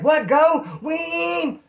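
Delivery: high hollered mishearing, then engine noise; imitating a yelling motorbike driver; retold shouting over noise. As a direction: holler back over the wind.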